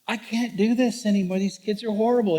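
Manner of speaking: hammed-up desperate griping; exasperated complaint. Retold venting to his mentor.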